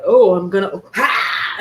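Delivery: mock gasp at scalding water; loud startled breath, acted out; shocked gasp. Acts out gasp at hot shower.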